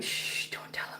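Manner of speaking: hushed, slow shushing whisper; conspiratorial whisper. Pretend. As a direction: shush them as if sharing a secret. Mock secret about the cat.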